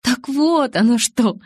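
slow, high giggling exclamation; с хихиканьем. Acted. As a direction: giggle the words out slowly. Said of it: "Я хихикнула". (медленно, со смешком)

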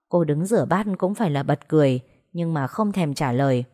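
plain reading voice

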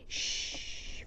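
soft unvoiced hush; shushing. A narrator's reading. Girl leaning on him, breathing a soft hush. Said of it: "fece Giada Lucente".

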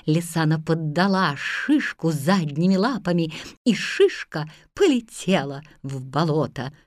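ordinary delivery, nothing marked

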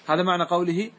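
ordinary delivery

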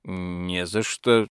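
low, quiet, slow and wary; distrustful. Acted. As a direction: answer warily. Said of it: "сказал рыцарь недоверчиво".

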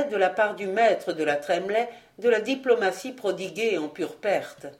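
ordinary delivery, nothing marked

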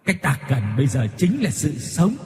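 eerie menacing ghost voice that builds into a sudden rush; a vengeful spirit promising torment; ma quỷ đe dọa rợn người; threatening ghost. A narrator's reading. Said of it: "nói với cái giọng hăm dọa".

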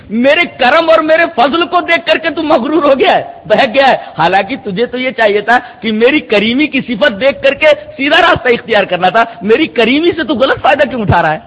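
ordinary talk, nothing marked

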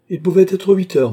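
loud, high answer from afar; calling back. A narrator's reading. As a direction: call the answer back from another room. Servant answers from next room.

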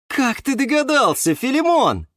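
high, delighted character voice; light up with happy surprise; beaming delight. A narrator's reading. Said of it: "просиял Юра". (радостно просияв, высоким голосом)

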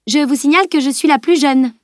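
put-on a young girl scolding her lagging friends. A narrator's reading. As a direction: scold the two boys from above. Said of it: "les fustigea-t-elle".

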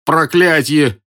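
high, pained exclamation; groaned curse. A narrator's reading. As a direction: groan it out as a beaten soldier. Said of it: "простонал солдат".

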